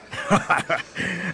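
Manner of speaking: high rolling chuckle; crime boss's laugh. A narrator's reading. Hidden boss amused by his guest, high chuckle. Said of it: "Boss B laughed".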